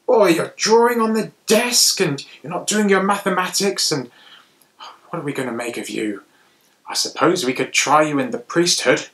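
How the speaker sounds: high, loud scolding teacher; stern teacher. Acted. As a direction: tell the boy off, exasperated.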